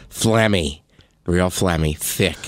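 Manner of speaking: low, thick, congested mock answer; phlegmy sick voice. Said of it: "How you feeling?".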